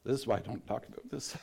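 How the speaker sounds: ordinary delivery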